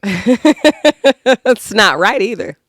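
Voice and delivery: drawn-out words carried on a laugh. Real, not acted. Cracking up at her own snot joke, laughing. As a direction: laugh through the pun.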